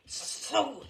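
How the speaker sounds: breathless hiss. A narrator's reading. Furious umpire hisses at the narrator, breathless. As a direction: spit it through your teeth. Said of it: "he hissed".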